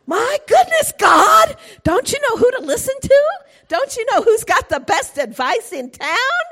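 high, loud, fast, exasperated rebuke; exasperated shout. Acted. Jonah telling God who to listen to, exasperated shout. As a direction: scold God like a know-it-all.

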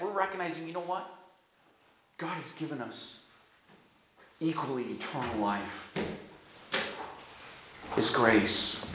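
softly sincere preacher's hush; tender hush. Real, not acted. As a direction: bring it down to a hushed, tender confession.

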